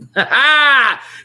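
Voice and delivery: loud high-pitched laugh; delighted shriek of a laugh; high burst of laughter. Real, not acted. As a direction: crack up at your own words. Laughs at own phrasing.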